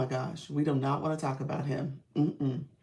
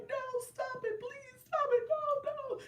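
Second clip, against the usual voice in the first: put-on scared little boy. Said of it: "He was like".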